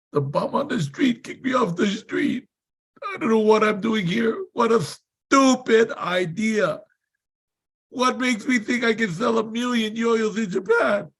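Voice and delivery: high, worked-up retold rant; acted-out frustrated outburst; exasperated self-mockery. Retold despairing outburst to mastermind.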